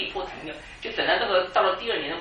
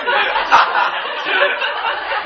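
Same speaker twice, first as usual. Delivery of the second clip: high loud laugh; loud laughter; 13 dB louder than usual. Real, not acted. Pastor laughing loudly with the congregation. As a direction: let the laugh ring out.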